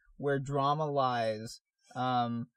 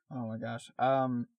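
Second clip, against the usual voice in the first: normal talking voice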